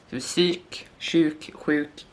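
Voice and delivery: psyk, sjuk, sjuk said with shifting first sounds; dialect variants. Shown as a demonstration. Teacher contrasting Swedish dialect pronunciations of sjuk. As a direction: compare the sj sounds one by one. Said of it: "some dialects in Sweden say sjuk".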